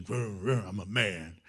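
low mock-macho growl over a pretend engine; hammed-up tough guy; mock macho man. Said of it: "rev the engine".

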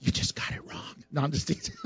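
voiceless, hushed teasing jab; teasing aside. Real, not acted. Preacher teasing the congregation about getting it wrong, hushed aside. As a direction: slip it in under your breath.

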